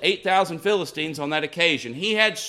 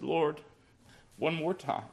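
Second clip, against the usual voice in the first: hushed prayer; 8 dB quieter than usual. Retold or imitated. Samson's quiet final prayer.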